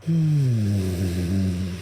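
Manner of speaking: sustained hum gliding gently downward; long exhaling hum. Shown as a demonstration. Meditation teacher models breathing out in stillness, long falling hum.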